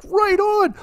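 very high, drawn-out right on; high gleeful mock cheer; excited right on. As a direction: squeal it like a thrilled kid. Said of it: "It's like".

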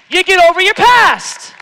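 loud, high shout on get over your past; preaching shout. Real, not acted. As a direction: belt it out at the congregation. Shouted repeat.

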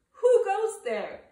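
shouted high, calling out who goes there; loud challenge. Acted. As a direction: call out loud and high into the dust. Skippy challenges the chihuahuas.

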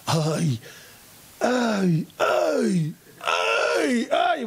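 mounting "ay" cries, rising in pitch; yelps of cold. Acted. Boy stepping down into freezing water, crying out. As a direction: yelp harder with each step. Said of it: "והוא צועק".

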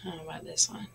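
soft playful whisper, no voice; breathy teasing whisper to the class. Real, not acted. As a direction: hush it like sharing a secret.

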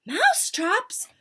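high-pitched surprised question; astonished cry. A narrator's reading. Witches react to their leader's announcement, astonished cry. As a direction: echo it in amazement. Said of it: "cried the witches".

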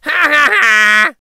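high, loud, drawn-out ha-ha-ha; big prankster's laugh in a high voice; boy's mocking laugh. A narrator's reading. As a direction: laugh uproariously at the fooled villagers. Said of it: "the boy laughed".

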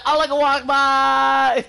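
slow, loud, high mock yell; hammed-up jeering call; mocking shout. Mocking the enemy player, slow loud high shout. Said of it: "He's like".

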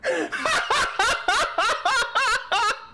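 long, high-pitched cackling fit; helpless laughter. Real, not acted. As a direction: cackle uncontrollably in rapid bursts. Cracks up at own bald look.

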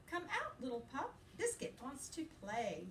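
lively, sing-song picture-book reading; reading to children. A narrator's reading. Teacher reading a puppy book to her class, playful and lively. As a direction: read it brightly to little listeners.

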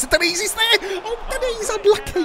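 high-pitched frantic near-miss call; squealed gamer commentary; excited match call. Real, not acted. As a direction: shoot up high as the chance comes. Chance builds, then missed.

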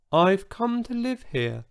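high, small, gentle voice; a giant with a tiny voice. A narrator's reading. Huge dinosaur tells Brenda of moving in, tiny-voiced. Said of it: "in a voice so tiny".

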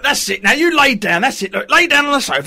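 ordinary talk

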